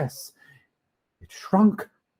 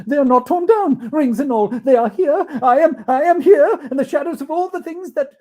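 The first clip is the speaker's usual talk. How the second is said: high and loud, tumbling joy; overjoyed Scrooge; 11 semitones higher, 9 dB louder than usual. Acted. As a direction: bubble over with relief.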